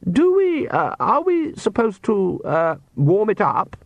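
high, slow, hesitant question; nervous. Acted. Wife humouring husband over the wine, nervously hesitant. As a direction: ask gingerly, halting on each uh. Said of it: "His wife smiled nervously".

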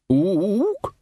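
high, rising hoot; eager questioning ape call; hopeful ape. A narrator's reading. Librarian hints to Vimes he could serve, hopeful. Librarian offers himself as best man.

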